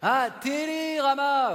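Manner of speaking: high, long stretched exclamation; sudden realisation. Acted. Partner finally decoding the puppet's word, loud realisation. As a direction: sing out the discovery slowly. Finally understands the garbled name.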